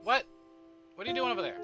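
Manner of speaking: ordinary talk, nothing marked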